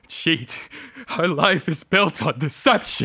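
from sharp accusation to shouting; building fury. Acted. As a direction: let the betrayal explode. Husband discovers the forgery.

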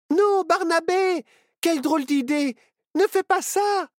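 very high, loud objection; alarmed protest. A narrator's reading. Friends beg Barnabé not to sing, alarmed. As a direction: cry out to stop a friend.

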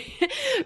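a high, falling bashful giggle; flattered giggle after the compliment. Real, not acted. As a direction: let a shy laugh tumble out.

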